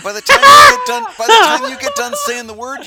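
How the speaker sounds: high and loud through real laughter; laughing at the long German word. Real, not acted. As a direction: crack up while getting the words out. Laughing at the joke.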